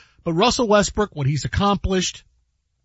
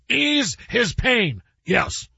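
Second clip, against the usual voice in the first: drawn-out, higher-pitched sportscaster call; Marv Albert; 4 semitones higher, much slower than usual. Acted. Host offers the movie line as a sportscaster, announcer voice. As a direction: stretch each word like a play-by-play announcer. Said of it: "I can do it like Marv Albert".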